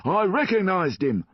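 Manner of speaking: high, loud, certain; loud insistence. A narrator's reading. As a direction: insist at full voice. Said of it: "heard a loud voice".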